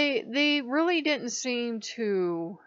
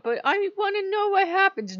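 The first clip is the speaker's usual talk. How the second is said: put-on high, pleading kid voice; whiny child; 12 semitones higher, 6 dB louder than usual. Begging Odin to let her play, childish whine.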